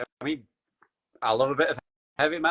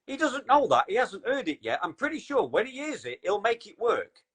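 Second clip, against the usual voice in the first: exasperated TV rant; 7 semitones higher than usual. Retold or imitated. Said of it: "I'm screaming at the T V".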